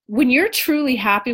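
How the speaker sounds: ordinary talk, nothing marked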